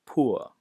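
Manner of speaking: British poor, said POO-uh; non-rhotic poor ending in a schwa; silent final R. Shown as a demonstration. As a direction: drop the R at the end of poor. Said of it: "They don't s-, pronounce the R".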